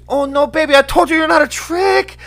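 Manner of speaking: very high, loud mock female voice; imitated girlfriend. Prison girlfriend placating her man, mock sweet talk. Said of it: "And she goes".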